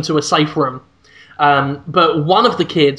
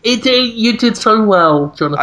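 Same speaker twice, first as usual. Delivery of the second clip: patronising praise; 4 semitones higher than usual. Pretend. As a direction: praise him like a kindergarten teacher. Reassuring like a teacher to child.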